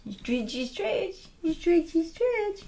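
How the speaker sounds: cooing pet talk. Real, not acted. Coaxing her dog up, high cooing baby talk. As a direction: coo at the pup in a squeaky high voice.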